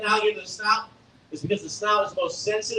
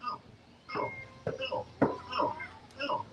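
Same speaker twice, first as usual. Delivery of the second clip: soft breathy ow calls; baby gator call; 11 dB quieter, much slower than usual. Shown as a demonstration. Handler showing the real baby gator call, soft chirps. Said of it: "It sounds like this".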